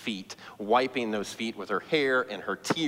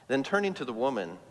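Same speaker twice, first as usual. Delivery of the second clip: usual speaking voice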